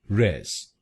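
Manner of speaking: रेस said alone as the syllable res; रेस as a single clipped syllable res; first syllable res. Shown as a demonstration. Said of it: "आरईएस हम बोलेंगे".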